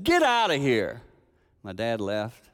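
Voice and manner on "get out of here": loud, slow command; stern order. Retold or imitated. Grandfather ordering dad to leave, loud slow command. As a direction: send him away, slow and firm.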